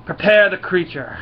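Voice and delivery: hammed-up commanding yell; queen's yelled command. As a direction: call out an order like an alien queen. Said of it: "Queen of the Brood yells out".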